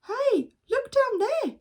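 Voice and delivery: high excited call. Acted. Boy spotting ducks, high excited call. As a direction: point it out eagerly. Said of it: "said Philip".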